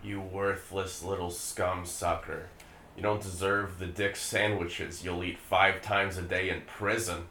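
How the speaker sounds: low, quiet, venomous sneer; low contemptuous insult. Acted. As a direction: spit it out with contempt. Father's ghost insulting son.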